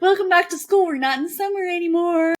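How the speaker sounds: teasing mom announcing school is back; mock cheery mom. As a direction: gleefully rub it in. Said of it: "And I'm like".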